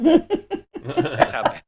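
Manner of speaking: laughing, fast and high. Real, not acted. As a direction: laugh through the words. Laughing at own bad accent.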